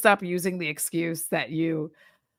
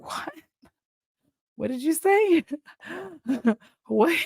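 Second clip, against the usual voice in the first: a much higher, incredulous "What did you say?"; mock confusion; 9 semitones higher than usual. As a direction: play baffled, straining to understand. Confused at a Baltimore accent.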